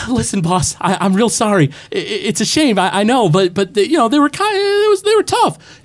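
high-pitched, stammering henchman; little voice. Acted. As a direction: make excuses to the boss in a tiny high voice. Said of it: "the little voice is like".

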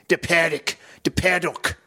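put-on lightly muddled Australian-South African accent on De Paddock. Imitating the pinball guy's muddled accent for friends. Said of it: "New Zealand or whatever he's supposed to be".

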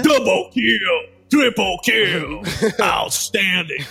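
slow, drawn-out announcer callout; fighting game announcer. Pretend. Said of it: "muita referência de joguinho online".

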